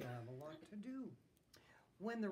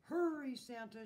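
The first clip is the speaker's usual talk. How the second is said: loud, high start, falling; whinny the first word out; neighing horse; 6 semitones higher, 9 dB louder than usual. A narrator's reading. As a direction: horse calling urgently. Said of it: "she neighed".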